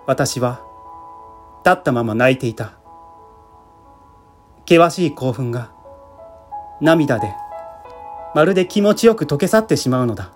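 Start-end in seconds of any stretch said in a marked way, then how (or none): none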